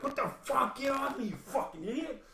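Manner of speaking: high strained yell mid-fight; retold yell. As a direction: shout it while shoving someone away. Retold fight, fending her off.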